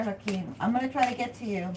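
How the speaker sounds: ordinary talk, nothing marked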